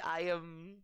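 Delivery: usual speaking voice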